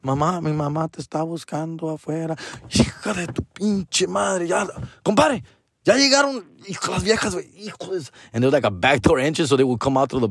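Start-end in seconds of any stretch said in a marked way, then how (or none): 0.0-2.4 put-on soft, timid child voice; small kid warning quietly; little kid. Young son telling his dad mom is looking, timid. 2.7-8.1 high, hurried cursing under his breath; frantic hushed swearing; panicked dad. Retold or imitated. Father hearing his wife arrived, panicked high cursing.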